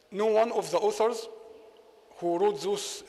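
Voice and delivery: ordinary talk, nothing marked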